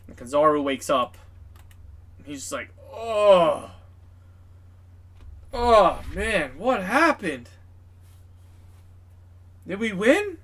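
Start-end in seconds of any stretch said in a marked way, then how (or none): 2.9-10.4 high, loud, swooping goofy voice; dazed waking admiral. Acted. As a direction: wake up clueless, wailing each question upward. Kizaru waking up dazed after battle.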